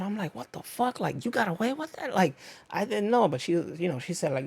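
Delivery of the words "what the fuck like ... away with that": high, hushed amazed gasp of disbelief; quietly floored that she got away with it; pitched-up whispery what-the-heck; stunned disbelief. Retold or imitated. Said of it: "I'm, like, freaking out".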